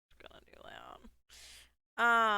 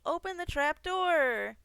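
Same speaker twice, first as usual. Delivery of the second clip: loud, slow, high command; drawn-out yelled order; shouted command; 7 semitones higher, 5 dB louder, slower than usual. Real, not acted. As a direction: bark it at the screen.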